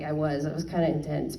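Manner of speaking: a soft, low self-admission; sheepish quiet confession; quiet admitting aside. Retold or imitated. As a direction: concede it under the breath. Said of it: "I'm like".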